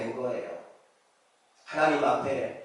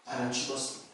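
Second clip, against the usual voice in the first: hushed prayer; 5 semitones lower, 15 dB quieter, faster than usual. Retold or imitated. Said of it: "한 열 번 정도를 그 기도를 반복해서 했대요".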